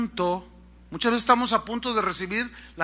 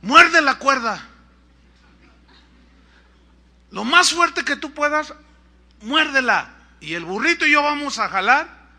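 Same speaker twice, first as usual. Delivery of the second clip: loud, higher acted instructions; calling down; 6 semitones higher, 7 dB louder than usual. Rescuer telling man to bite rope, calling down. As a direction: shout orders down to the climber.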